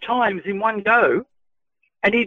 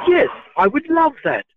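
higher, bright-eyed yes, keen to spar; a smiling, eager acceptance; 9 semitones higher than usual. Retold or imitated. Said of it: "That's how I spoke to him".

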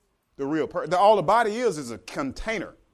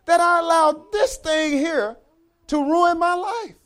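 slow, much higher, stunned lament; regretful disbelief; 10 semitones higher, 5 dB louder than usual. Pretend. People realizing they were tricked by the devil, stunned slow lament. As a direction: mourn how you were fooled. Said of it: "and then it said".

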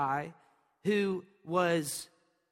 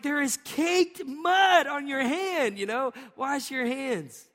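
high, slow, mock-exasperated parent; lightly voiced dad scolding a muddy kid; 6 semitones higher, 3 dB louder, slower than usual. Father scolding his child about muddy hands, mock exasperated and high.

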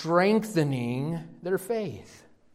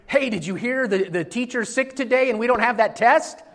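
higher-pitched, eager news-breaking; excited student; 8 semitones higher, 4 dB louder than usual. Acted. Student telling classmates the teacher's sick, excited. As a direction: spill the good news like a thrilled kid.

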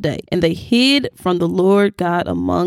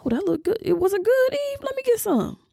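high, soft, dopey Adam voice; a hint of a careless husband, hushed and higher; mock dim Adam; 11 semitones higher, 6 dB quieter than usual. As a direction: play Adam as clueless and easy. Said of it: "What did he do?".

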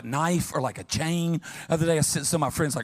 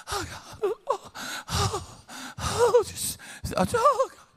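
much higher, breathy lovesick sighs; mock swooning; much slower than usual. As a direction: swoon like a smitten young couple. Mocking young lovers' swooning.

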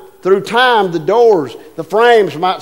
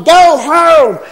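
high, loud holler; coach hollering; 10 semitones higher, 7 dB louder than usual. Retold or imitated. Coach yelling to the runner on third, loud holler. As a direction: yell it across the field like a coach. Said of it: "the coach is hollering".

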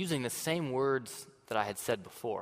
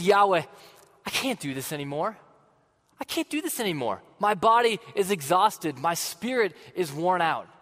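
higher, louder, pleading cry of exhaustion; desperate prayer; 5 semitones higher, 5 dB louder than usual. Acted. Elijah crying out to Yahweh in despair, a higher, louder plea. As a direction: cry out to God at the end of your rope.

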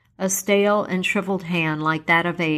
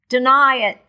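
higher, loud outcry; spirit cries out; 7 semitones higher, 7 dB louder than usual. Acted. The spirit cries out at Scrooge, loud and high. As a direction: fling the words at Scrooge. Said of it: "cried the spirit".

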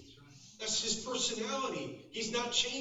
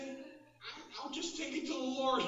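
high, choked-up mock composure; hammed-up tearful brave face; holding back tears; 6 semitones higher than usual. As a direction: act out fighting off sobs. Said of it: "I'm holding back tears".

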